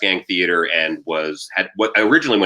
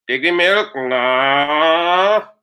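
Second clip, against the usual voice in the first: higher Trinidadian moan, sliding upward; whining Trinidadian accent; 6 semitones higher, 4 dB louder, much slower than usual. Acted. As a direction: complain like a mooing Trini cow. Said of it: "constantly whining and complaining".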